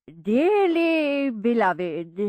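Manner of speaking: very slow, solemn churchy drone; intoning vicar. A narrator's reading. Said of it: "he intoned".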